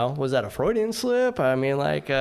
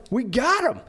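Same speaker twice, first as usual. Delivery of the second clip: much higher, excited stranger's voice; stranger's carefree reply; 13 semitones higher, 4 dB louder than usual. Retold or imitated. Sailor imitating the stranger waving off going back to the ship, high and giddy. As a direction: toss it off high and giddy, already thinking of beer. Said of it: "He's like".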